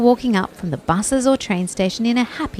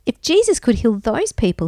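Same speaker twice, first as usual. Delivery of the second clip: ordinary delivery, nothing marked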